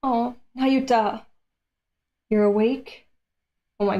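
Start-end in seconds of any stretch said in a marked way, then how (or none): none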